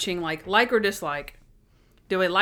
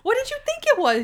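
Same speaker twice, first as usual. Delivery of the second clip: coy Natalie voice; faster than usual. Retold or imitated. Assistant teasing her boss in a very high, coy voice. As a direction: present yourself as the gift, coyly and very high.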